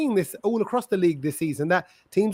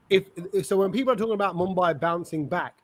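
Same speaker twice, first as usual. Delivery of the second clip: ordinary talk, nothing marked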